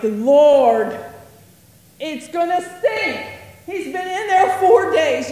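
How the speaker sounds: loud, fretful objection to the Lord; alarmed protest. Retold or imitated. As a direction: blurt out a worried protest. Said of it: "And I'm like".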